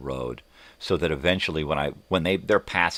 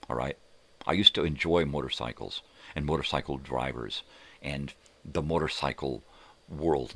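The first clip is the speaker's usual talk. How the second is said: normal talking voice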